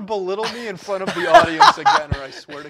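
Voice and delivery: loud fast mock-angry threat, laughing through it; loud joking fake fury at the co-host; mock outrage. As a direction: pretend to snap at him, half laughing.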